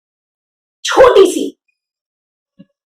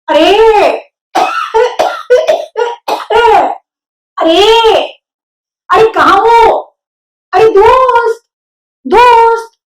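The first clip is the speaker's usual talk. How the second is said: shouting; 9 semitones higher, much slower than usual. A narrator's reading. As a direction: shout out across the farm at dawn. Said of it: "Wilbur ने जोर से चिल्लाया".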